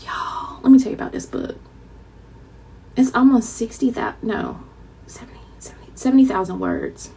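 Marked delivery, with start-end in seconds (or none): 0.1-1.6 breathy stage whisper, leaning in; dramatic whisper. Acted. Dramatic secret to viewers. 5.1-5.9 whispered self-correction. Real, not acted. Correcting herself under breath.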